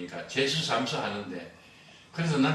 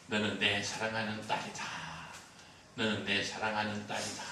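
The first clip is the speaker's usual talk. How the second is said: softer, hushed divine voice, lightly done; voice of God; 6 semitones lower, 7 dB quieter than usual. Retold or imitated. Retelling the voice the deaconess heard, hushed and soft. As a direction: murmur it like a gentle voice heard in the night. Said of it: "하나님의 음성이 들렸어요".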